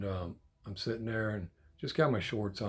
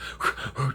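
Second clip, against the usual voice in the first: short burst of mock panting; breathing hard; 3 semitones higher than usual. Shown as a demonstration. Grandpa shows how he tried to talk, breathing hard. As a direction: demonstrate winded huffing. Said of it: "I'm breathing hard, but I'm not gonna go through all that".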